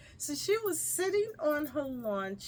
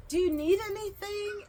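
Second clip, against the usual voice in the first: high, louder, eager question; lightly acted, pitched-up offer; imitated kindly offer; 11 semitones higher, 5 dB louder than usual. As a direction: mimic a helpful stranger checking in. Imitates lady offering help.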